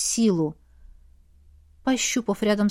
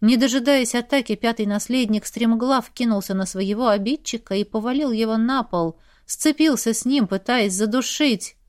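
plain reading voice

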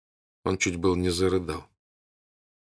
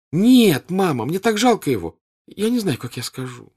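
put-on distressed girl; 10 semitones higher, 6 dB louder than usual. A narrator's reading. Natasha distressed.

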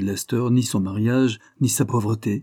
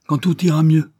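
higher and louder, bitterly ironic echo; ironic repeat; 7 semitones higher, 8 dB louder than usual. Acted. Wilfrid mocks the lawyer's hopeful promise, ironic and loud. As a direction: throw his words back at him with scorn. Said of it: "répéta ironiquement Wilfrid".

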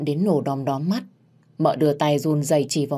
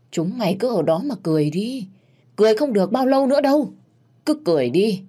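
vengeful woman; 4 semitones higher than usual. Acted. Vowing to take back her honour, vengeful. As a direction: warn your enemies, rising in anger.

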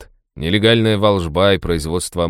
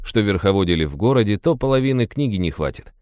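ordinary delivery

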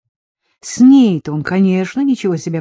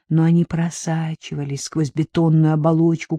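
ordinary delivery, nothing marked

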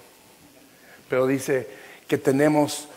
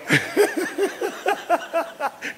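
high, breathy laugh at his own joke; 13 semitones higher than usual. Real, not acted. Pastor laughing at his own joke mid-sermon. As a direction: crack up at yourself. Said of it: "Yo mismo me estoy haciendo reír".

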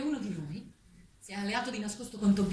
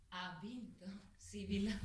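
whisper; 9 dB quieter, much slower than usual. Acted. Aside after the vote.

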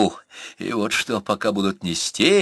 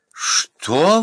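high-pitched, indignant cry of disbelief; taken aback, voice leaping high; startled exclamation; 8 semitones higher, 3 dB louder than usual. A narrator's reading. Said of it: "воскликнула Анна".

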